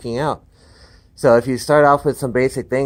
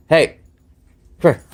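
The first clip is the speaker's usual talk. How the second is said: louder, higher quick call; calling the dog; 7 semitones higher, 4 dB louder than usual. Real, not acted. Trainer calling his dog to come here. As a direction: snap her attention back to you. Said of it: "calling the dog".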